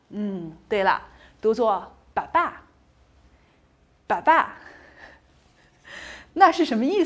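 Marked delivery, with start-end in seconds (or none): 2.1-4.6 “爸爸” with the second syllable stressed; stress on last syllable. Shown as a demonstration. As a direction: land the stress on the final syllable of “爸爸”. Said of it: "重读音节是最后一个音节". (“爸爸”重读最后一个音节)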